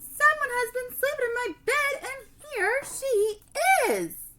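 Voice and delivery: high, loud squeaky cub voice; squeaking baby bear. Acted.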